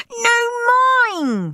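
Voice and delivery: high, sharp scream. A narrator's reading. Steve screams back at Henry over the presents. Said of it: "screamed Steve".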